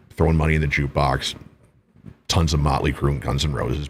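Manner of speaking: normal talking voice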